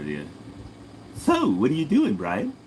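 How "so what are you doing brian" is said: swooping high cartoon-baby voice; Stewie. Baby talks to Brian the dog, swooping cartoon voice. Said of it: "Can I do Stewie from Family Guy?".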